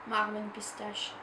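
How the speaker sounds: ordinary delivery, nothing marked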